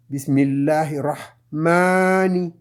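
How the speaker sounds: Bismillahirrahmani slowly, the ma held for two beats; stretched ma in Arabic. Shown as a demonstration. Teacher showing the long ma to students, slow recitation. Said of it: "Ma, deux".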